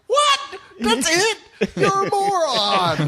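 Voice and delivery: exasperated disbelief. Retold or imitated. A viewer mocks the villain's lame motive, exasperated. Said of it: "you're just like".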